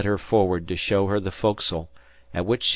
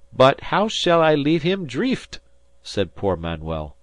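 much higher, louder, foreign-lilted sailor; a kindly Portuguese sailor pleading, much higher; Portuguese fisherman; 6 semitones higher, 6 dB louder than usual. A narrator's reading. Manuel's accented fisherman voice.

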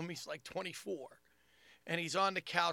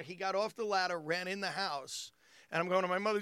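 ordinary talk, nothing marked

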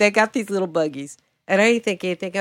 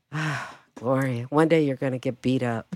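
low, soft note to self; rueful self-scolding; 6 semitones lower, 7 dB quieter than usual. Retold or imitated. Scolds herself in retold thought.

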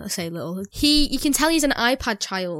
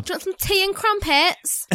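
higher, put-on posh London voice; mock London accent; 8 semitones higher than usual. Shown as a demonstration. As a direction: offer it in a caricatured London accent. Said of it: "Can you do a London accent?".

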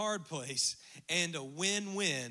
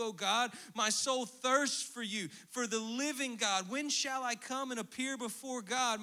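higher, slow, solemn recital; psalm reading; 5 semitones higher than usual. Acted. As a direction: read it like a prayer.

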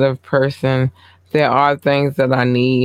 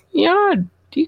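laughing squeal. Real, not acted. Laughing shout-out.

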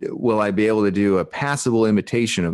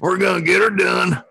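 Southern [name]; 9 semitones higher than usual. Acted. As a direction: shout it like a rowdy Southern good ol' boy. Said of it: "Southern [name]'s coming".

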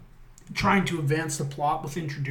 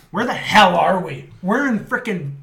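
louder, raised, mock disbelief; exasperated outburst; 5 semitones higher, 9 dB louder than usual. Lost in the countryside, mock exasperated, louder. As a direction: throw up your hands in disbelief.

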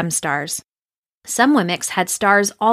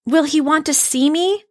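cried out; 8 semitones higher than usual. A narrator's reading. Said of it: "Punchinello cried out".

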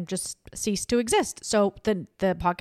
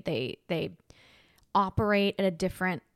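normal talking voice

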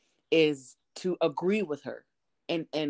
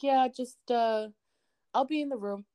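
higher, hesitant sheepish voice; sheepish high grandpa; 8 semitones higher than usual. Retold or imitated. Grandpa backing away from angry grandma, sheepish. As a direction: back away nervously with a grin. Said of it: "he's like".

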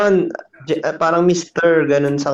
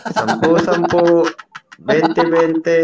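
higher-pitched mock market hawker; vendor call; 3 semitones higher, slower than usual. Calling to regular customers at a stall, mock vendor call. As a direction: call out prices like a street seller.

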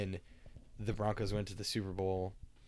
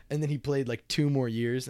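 ordinary delivery

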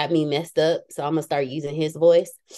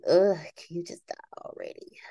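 breathy whisper of irritation; whispered aside; 5 dB quieter than usual. Retold or imitated. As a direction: hiss it under your breath, annoyed. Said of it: "I just be like".

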